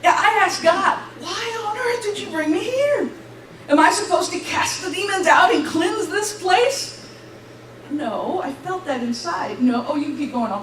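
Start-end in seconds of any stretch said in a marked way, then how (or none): 1.2-7.1 higher-pitched, exasperated questioning of God; exasperated plea. Retold or imitated. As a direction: plead upward for an explanation.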